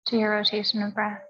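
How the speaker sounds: calm, measured yoga teacher's voice with long pauses; guided meditation. Real, not acted. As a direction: count the breaths evenly, leaving space between phrases. Leads breathing and meditation.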